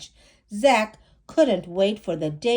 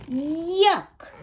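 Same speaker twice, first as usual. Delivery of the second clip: higher yelp of disgust; boy shrieking at sticky sap; disgusted scream; 8 semitones higher than usual. Acted. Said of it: "Zach screamed".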